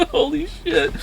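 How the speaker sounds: words squeezed out through high laughter; laughing. Real, not acted. Laughing hard after bee story.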